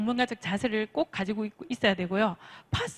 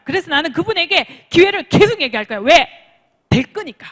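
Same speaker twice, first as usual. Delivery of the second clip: high, loud rousing declaration; full of conviction; 5 semitones higher, 8 dB louder than usual. Shown as a demonstration. Coach voicing self-belief in the business, loud conviction. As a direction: declare it forcefully, punching each phrase. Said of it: "그런 생각과 믿음을 가지고".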